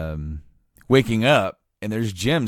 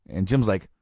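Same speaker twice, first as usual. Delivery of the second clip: usual speaking voice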